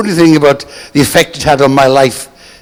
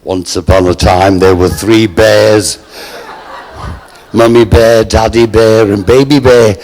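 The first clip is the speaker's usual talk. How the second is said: [name] reading a bedtime story; 5 semitones lower than usual. Retold or imitated. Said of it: "looked at the menu and said".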